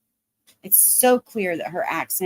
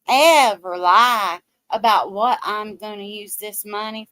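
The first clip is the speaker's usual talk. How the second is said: mock down-home Southern lady, sugary and drawn out; put-on Southern accent. Mocking a grifter pleading to donors, exaggerated fake Southern accent. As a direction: overdo a fake Southern drawl, protesting innocence. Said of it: "Her accent is a put on".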